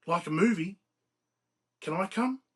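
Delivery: hopeful, pitch climbing sharply; excited. A narrator's reading. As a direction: ask like an excited tagalong. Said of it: "Philip's eyes lit up with excitement".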